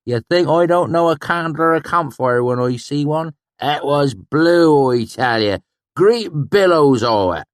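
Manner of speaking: high, loud retort; hot-tempered swineherd. A narrator's reading. Sheem retorting hotly, high and loud. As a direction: snap back scornfully.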